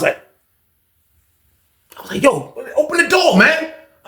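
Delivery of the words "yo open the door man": high shouted demand; retold shout. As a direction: holler for the door to open. Yelling to open the door.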